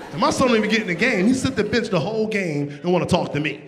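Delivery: ordinary talk, nothing marked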